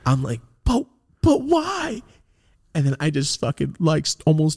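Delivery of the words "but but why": high, drawn-out squeak of disbelief; hurt, bewildered plea. Retold or imitated. Kid asking his friend why, hurt high-pitched plea. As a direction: plead it slowly, voice pitched way up.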